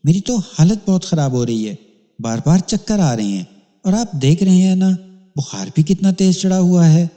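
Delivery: a lightly voiced ailing wife; sick woman. Feverish wife complains of illness.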